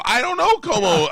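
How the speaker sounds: high, loud comic exasperation; imitating a frustrated phone shout. Customer struggling to make driver understand, exasperated high shout.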